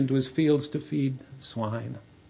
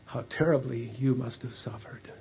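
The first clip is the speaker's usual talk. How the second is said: soft whispered compassion; tender whisper; 5 dB quieter than usual. Acted. Father comforting his returned son in a tender whisper. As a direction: hold him close and whisper it.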